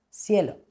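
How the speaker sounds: "cielo" con S inicial, como "sielo"; seseo. Shown as a demonstration. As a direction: di "cielo" seseando. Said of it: "utilizando la S". ("cielo" con ese en vez de ce)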